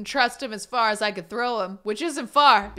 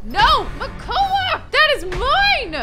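much higher, loud and drawn out; outraged yell; 8 dB louder, slower than usual. Real, not acted. She yells at Makoa for stealing her food, drawn out. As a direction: scold the food thief at full volume.

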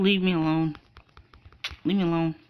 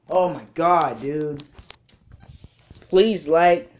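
ordinary delivery

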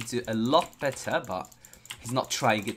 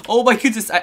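high, loud alarmed cry; 11 semitones higher, 13 dB louder, faster than usual. Real, not acted. Gamer nearly dying in lava, high loud alarmed cry.

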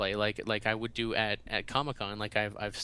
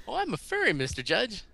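eager squeak; 10 semitones higher than usual. Retold or imitated. Answering Judge's question eagerly.

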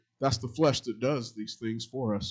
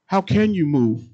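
CAN pushed higher and louder; louder emphatic repeat; 4 semitones higher, 7 dB louder than usual. Acted. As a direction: hit the repeat of "How can you move?" with force. Repeats question, second louder.

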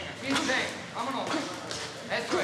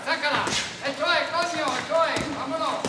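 raised, higher cheer of approval; fired-up shout; 4 semitones higher, 4 dB louder than usual. Real, not acted. Coach to boxer on getting the punch right, fired-up shouting. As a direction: shout praise as the punch lands.

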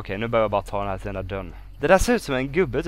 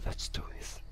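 hushed, whispered self-encouragement; 12 dB quieter than usual. Real, not acted. Weary player psychs self up for the task, whispering. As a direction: whisper it after a sigh.

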